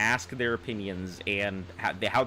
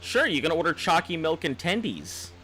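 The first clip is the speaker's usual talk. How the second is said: sarcastic, higher-pitched mock approval; sarcastic fake praise; 6 semitones higher than usual.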